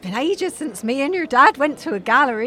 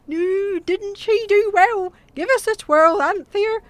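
higher-pitched game-show host impression; Bruce Forsyth; 5 semitones higher than usual. Mum showing off to her family, game-show host impression. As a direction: play the catchphrase-spouting TV host. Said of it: "I could do a better Bruce Forsyth".

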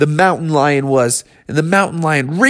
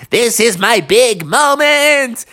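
higher, slow, stretched-out declaration; triumphant sloth; 6 semitones higher than usual. Acted. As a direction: draw it out like a sloth savouring glory. Sloth relishes its moment.

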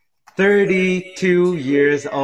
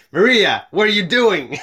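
raised, loud "what are you doing"; surprised call-out; 5 semitones higher, 4 dB louder than usual. Retold or imitated.

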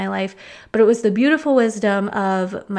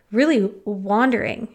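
ordinary talk, nothing marked

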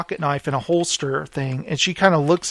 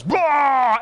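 high mock yell of getting zapped; imitated taser cry; 7 semitones higher than usual. Tasered man in retold scene, high mock yell. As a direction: blurt out a cartoonish zapped cry.

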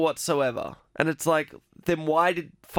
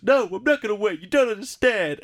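higher, louder, hammed-up stubborn protest; retelling Finn's defiant protest in a raised mock voice; mock Finn outburst; 7 semitones higher, 4 dB louder than usual. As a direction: play Finn refusing to wait, pushed up and loud. Finn's retold outburst at Poe.

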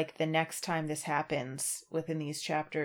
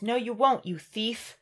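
sharp, high, loud accusing cry; angry accusation; 5 semitones higher, 5 dB louder than usual. Acted. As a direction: snap at him like a possessive thief. Frodo snaps at Sam.

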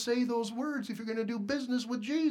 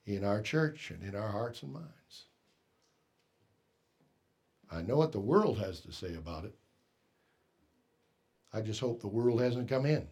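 soft, much quieter, drawn-in preaching; hushed musing; 11 semitones lower, 9 dB quieter than usual. Acted. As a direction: wonder aloud gently to the congregation. Quiet reflective passage in sermon.

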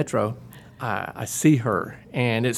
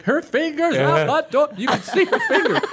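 half-laugh, half-panic at the staff; panicked laughing yell; 13 semitones higher than usual. Retold or imitated. Husband shouting to station staff about his wife's trapped finger. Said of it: "I'm like".

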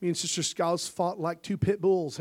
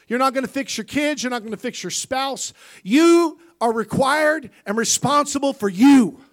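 firm preaching push; 8 semitones higher, 11 dB louder than usual. Acted. Preacher pressing the point.

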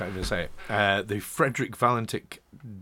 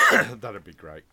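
coughing fit; 3 semitones lower, 7 dB louder, slower than usual. Real, not acted. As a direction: voice rough after a cough. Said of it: "Sorry I'm coughing so much".

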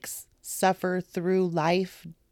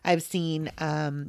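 normal talking voice

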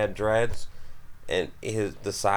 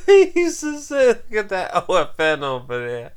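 laughing; 10 semitones higher, 8 dB louder than usual. Real, not acted. Laughing while quoting friend.

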